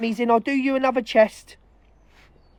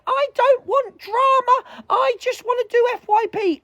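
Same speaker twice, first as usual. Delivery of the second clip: mocking prissy voice; 11 semitones higher than usual. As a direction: sneer it out like a fake sweet influencer.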